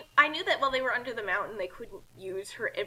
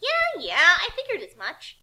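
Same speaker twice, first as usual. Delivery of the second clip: dismissive heroine; 7 semitones higher, 5 dB louder than usual. Acted. Kasumi dismissive.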